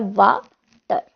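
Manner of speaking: "वाटर" broken into वा, pause, टर; two separate syllables. Shown as a demonstration. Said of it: "दो syllables से मिलकर".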